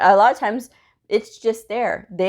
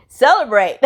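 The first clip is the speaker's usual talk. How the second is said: louder, bright cheer on the word; cheerful celebrate; 4 semitones higher, 6 dB louder than usual. Real, not acted. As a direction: cheer it out like good news. Cheering correct doctor codes.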